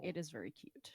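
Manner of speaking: whispered aside; soft unvoiced whisper. Real, not acted. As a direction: breathe it softly, no voice. Whispered aside about pets.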